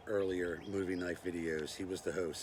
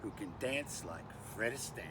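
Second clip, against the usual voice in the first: fake Australian accent; 4 dB quieter than usual. Bushman introducing himself to YouTube, fake Australian accent. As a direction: put on a bad Australian accent. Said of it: "with my fake Australian accent".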